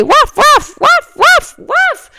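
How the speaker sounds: very high yapping barks; yapping dog. A narrator's reading. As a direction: yap like a tiny angry dog. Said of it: "se met à hurler".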